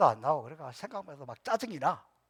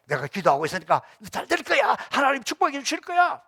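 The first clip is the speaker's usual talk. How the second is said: upbeat encouragement; 8 semitones higher, 7 dB louder than usual. Retold or imitated. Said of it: "지금은 그래도".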